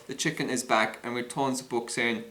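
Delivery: normal talking voice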